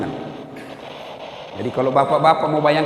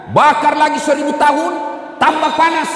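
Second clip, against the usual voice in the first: high, raised hellfire sermon; fiery preaching; 13 semitones higher, 4 dB louder than usual. Acted. Preacher warning the men about hellfire, fervent preaching. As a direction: preach it with rising force.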